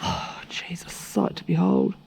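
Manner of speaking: hushed whisper of admiration; swooning whisper. Real, not acted. Vlogger swooning over the instructor, whispered. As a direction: whisper it, lovestruck.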